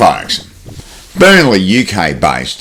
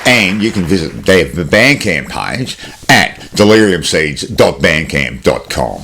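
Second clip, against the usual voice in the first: normal talking voice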